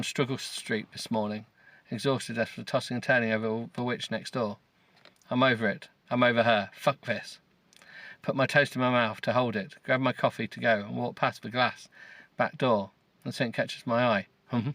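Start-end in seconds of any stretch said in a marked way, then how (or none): none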